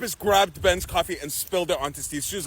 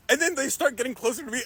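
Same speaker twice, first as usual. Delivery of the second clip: usual speaking voice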